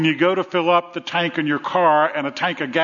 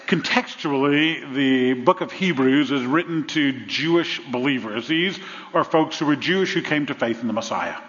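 ordinary talk, nothing marked

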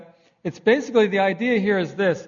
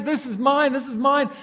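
put-on high, possessive toddler voice; a pouty two-year-old claiming his toys; mock toddler; 5 semitones higher than usual. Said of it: "and he says".

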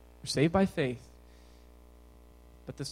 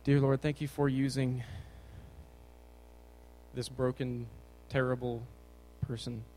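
low, soft, earnest prayer; hushed, halting closing prayer in a lowered voice; 4 semitones lower, 6 dB quieter than usual. Real, not acted. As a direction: pray quietly and humbly, with long pauses.

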